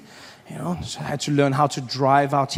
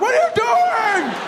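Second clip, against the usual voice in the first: high, loud, slow outraged yell; yelling driver; 3 dB louder, slower than usual. Retold or imitated. Other drivers yell at the wrong-way driver, high outraged shout. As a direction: bellow at the fool on the wrong side.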